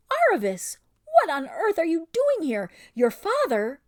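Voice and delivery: loud, high delighted cry; top of her voice. A narrator's reading. Lasraleen spotting her old friend, loud high cry. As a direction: burst out at full volume. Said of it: "burst out at the top of her voice".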